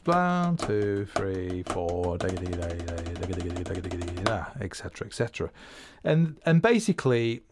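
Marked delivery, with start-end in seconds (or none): 0.0-2.2 rhythmic count-in. Shown as a demonstration. Drummer counting a bar of flams, rhythmic counting. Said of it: "a bar of doubles". 2.2-4.6 low, soft, rattling double strokes; drum speak. Shown as a demonstration. Drummer voicing a bar of doubles, low drum speak. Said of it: "a bar of doubles".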